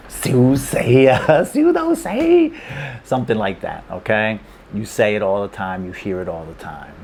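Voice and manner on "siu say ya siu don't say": slow, rising into a very high laughing squeal; high-pitched laughing delivery. Retold or imitated. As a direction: giggle the phrase out at a dinner with friends. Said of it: "you often hear".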